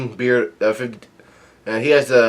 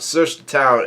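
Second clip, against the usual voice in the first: ordinary talk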